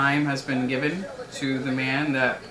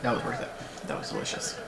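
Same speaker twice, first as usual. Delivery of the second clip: whispered satisfaction; 9 semitones lower, 11 dB quieter than usual. Real, not acted. Savoring ice cream cake.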